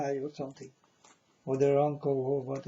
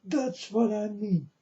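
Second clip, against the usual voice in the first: quoting the brown man; 7 semitones higher, slower than usual. Retold or imitated. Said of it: "And he told me".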